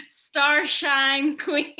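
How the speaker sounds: ordinary talk, nothing marked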